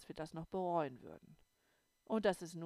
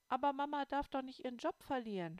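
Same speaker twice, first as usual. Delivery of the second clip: despairing sob; 7 semitones higher, 4 dB louder, faster than usual. A narrator's reading. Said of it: "schluchzte ich verzweifelt".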